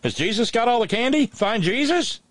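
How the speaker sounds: high mock Southern question; mock dialect question. Host reads a listener's joke question in a high mock Southern voice. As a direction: ask it high like a puzzled yokel. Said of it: "asked David in South Carolina".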